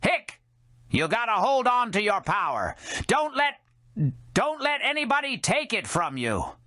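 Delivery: high tipsy voice broken by hiccups; drunk woman hiccuping. Acted. Drunk Scamala urging Joe to hold his power. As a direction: lecture him between hiccups, sloshed.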